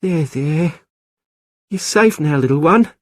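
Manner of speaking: put-on high, gentle cooing to a baby; tender soothing. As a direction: comfort the infant in your arms. Isabel cuddling the baby.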